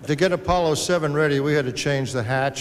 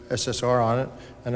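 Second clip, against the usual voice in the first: ordinary talk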